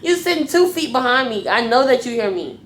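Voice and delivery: heated retold telling-off; going off. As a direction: call them out, re-enacting the blow-up. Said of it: "I was literally going off on them".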